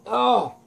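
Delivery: high "oh" of a villain struck; high, falling cry of impact; pained hit reaction. Acted. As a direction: yelp as if kicked in the face. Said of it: "Elektra goes".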